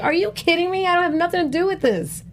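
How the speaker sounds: high-pitched acted indignation; mock pissed off. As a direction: imitate her outraged disbelief in a high pitch. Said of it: "'Cause she was pissed".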